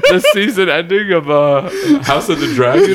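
laughing while talking. Real, not acted. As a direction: let laughter break through the words. Laughing while mentioning the show.

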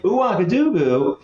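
high, drawn-out mock disbelief; astonished echo. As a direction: repeat the odd name as if amazed. Narrator echoes answer in surprise.

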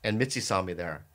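ordinary talk, nothing marked

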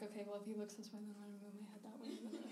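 very soft, hurried thinking aloud; mental checklist. Acted. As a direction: run through it quickly under your breath. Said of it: "doing the checklist in my head".

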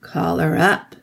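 sinister monotone command; eerie creature, level and grave; gray, terrible voice. A narrator's reading. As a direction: summon with cold gravity. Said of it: "said the gray voice".

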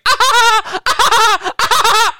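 very high, loud hammed-up laughing; mock crowd laughter. Crowd laughing at the bit, very high mock. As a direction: cackle like a fawning audience. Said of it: "everyone's like".

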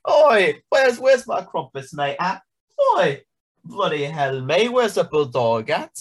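high, loud hammed-up Cockney with slow "crumpets"; mock British accent. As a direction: keep pushing the silly English caricature. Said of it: "That went on for uncomfortably long".

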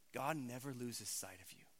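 ordinary delivery

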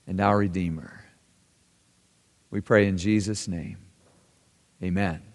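low, soft, unhurried prayer voice; closing prayer. Acted. Pastor praying softly over the congregation, hushed and reverent. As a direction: pray it quietly, reverently.

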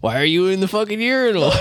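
put-on laughing through a drunk quote. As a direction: laugh while quoting yourself. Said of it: "And I'm like".

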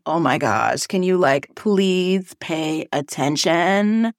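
put-on low, drawn-out Valley Girl; Valley Girl accent. Teacher begging her class for attention in a Valley Girl accent. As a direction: do a Valley Girl, dragging out the last words. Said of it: "talk in a Valley Girl accent".

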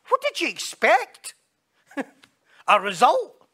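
mock disbelief. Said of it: "we can all say".

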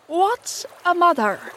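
high, slow, baffled question; ignorant Smee. A narrator's reading. Smee puzzled by an unknown word, ignorant. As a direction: ask slowly and high, clueless. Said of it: "asked the ignorant Smee".

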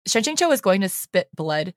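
plain reading voice

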